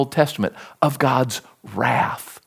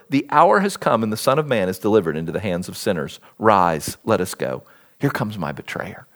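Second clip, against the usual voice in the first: lower, measured Bible reading; scripture reading; 6 semitones lower, faster than usual. Acted. Preacher reading Gethsemane scripture to his church, solemn and low. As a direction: read the passage solemnly to the congregation. Reads Gethsemane passage aloud.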